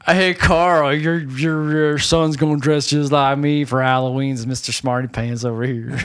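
Nick's dad impression. Dad teasing Carl about Halloween, low dad voice. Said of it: "You sound like Nick's dad".

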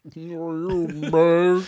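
hammed-up slurred cry, as if mid-stroke; Mel. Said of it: "I wouldn't talk like that".

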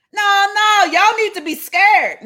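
high-pitched, agitated warning; mad. Acted. Warning the crowd that Jesus is angry, worked up. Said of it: "he mad".

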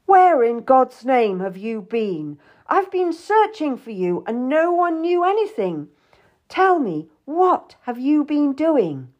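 high, emphatic, indignant demand; angry. A narrator's reading. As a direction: demand answers, stretching the key words angrily. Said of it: "He looked angrier than I'd ever seen him".

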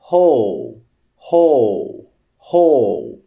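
"whole" said with an h and no w glide, like hole; "whole" starting on a breathy h, no w; English whole with h sound. Shown as a demonstration. Teacher showing students "whole" is an exception, h and no w. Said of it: "A palavra whole com W H".

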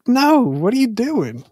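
high, drawn-out mock disbelief; retold scolding. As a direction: pull up a friend with a long, high "no".

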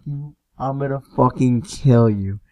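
understated retold fury, volume held in; mock angry threat. Host to friend who wrecked the mudroom, mock angry threat. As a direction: threaten him without raising your voice. Retelling anger at clumsy friend.

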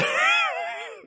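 very high-pitched real giggle; sudden squeal of laughter. Real, not acted. Cracks up while recapping.

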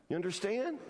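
usual speaking voice

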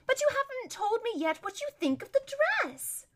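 high, airy society-lady voice; vain wheedling. Acted. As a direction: fish for praise of your dress. Vain lady fishes for compliment.

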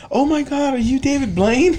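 imitating excited fan. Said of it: "he's like".

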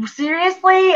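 a high, outraged "Seriously?"; indignant disbelief, pitched high; mock incredulous. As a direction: jump way up in disbelief. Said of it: "I was like".

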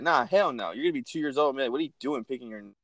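ordinary talk, nothing marked

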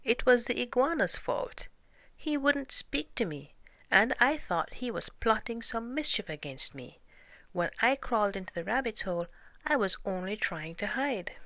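high, complaining snake voice; python's cry. A narrator's reading. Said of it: "he cried".

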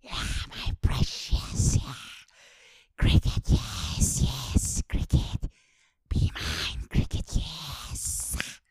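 loud, rasping whispered creature voice, slow and hissing; unvoiced Gollum rasp, drawn out on "cricket". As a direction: crawl in as Gollum craving his precious. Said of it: "like fucking Gollum".

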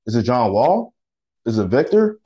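ordinary delivery

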